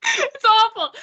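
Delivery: "It's awful" said high and loud through real laughter; giggling "It's awful"; laughing. Real, not acted. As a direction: laugh it out, embarrassed. Laughing at her failed accent.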